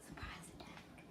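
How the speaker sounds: breathy sneaky whisper. Pretend. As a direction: whisper it like a secret. Whispered mock threat.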